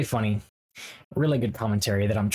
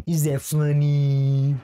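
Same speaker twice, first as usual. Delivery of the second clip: long drawn-out, louder final "funny"; hammed-up question; 3 semitones higher, 5 dB louder, much slower than usual. YouTuber asking viewers about his banter, hammed-up and drawn out. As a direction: stretch funny into a big sustained note.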